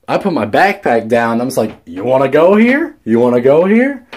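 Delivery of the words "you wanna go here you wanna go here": mock challenge, pitched up; retold challenge. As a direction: act out daring someone to fight. Retells squaring up for a fight.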